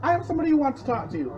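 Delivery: imitating Mum. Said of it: "Mum goes".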